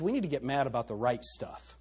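ordinary talk

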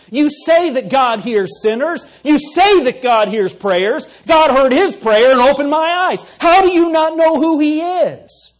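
loud, high, rising defiant voice; defiant outburst. Acted. Blind man defiantly answers the leaders.

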